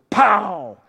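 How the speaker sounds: loud sudden shout of "Pow!"; thunderclap imitation. Preacher imitating a lightning bolt for Iowa listeners, loud sudden pow. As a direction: snap it out like lightning striking. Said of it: "and goes".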